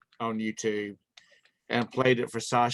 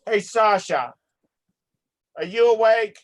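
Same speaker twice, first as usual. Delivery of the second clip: raised, higher-pitched call; 7 semitones higher, 4 dB louder than usual. Real, not acted. Host calls Sasha to see if awake, calling out. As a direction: call to someone who may be asleep.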